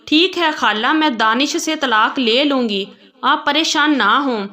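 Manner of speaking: ordinary delivery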